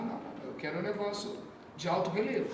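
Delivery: ordinary talk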